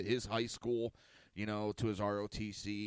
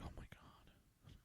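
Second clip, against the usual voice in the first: barely voiced, slow disbelief; stunned whisper; 19 dB quieter, slower than usual. Father to neighbor, amazed at the scrawny bully, hushed whisper.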